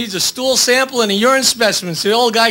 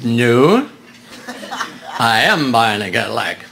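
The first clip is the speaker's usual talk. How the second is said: much lower put-on customer voice. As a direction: drop way down for the customer.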